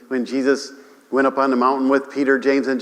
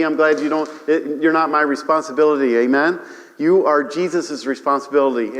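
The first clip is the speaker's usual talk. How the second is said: ordinary talk, nothing marked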